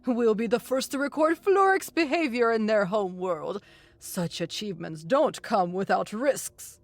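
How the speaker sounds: contained excitement in the professor's voice; a touch of eager anticipation, settling at the end; undercurrent of excitement. A narrator's reading. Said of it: "an undercurrent of excitement in his voice".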